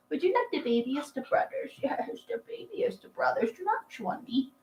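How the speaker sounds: high, soft cooing with a laugh. Real, not acted. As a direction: sweet-talk the puppy in your tiniest voice. Cooing to the dog.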